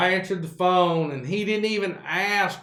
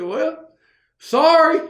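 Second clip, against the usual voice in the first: high, louder sorry; mock sheepish apology; 11 semitones higher, 7 dB louder, slower than usual. Worker to boss after being told off, loud sheepish sorry. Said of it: "I said".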